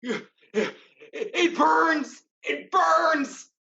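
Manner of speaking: high, loud pained scream. Acted. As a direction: cry out as it burns you. Villain burned by magic.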